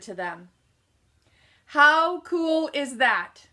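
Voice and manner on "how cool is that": delighted emphasis. Real, not acted. Climax of story to audience.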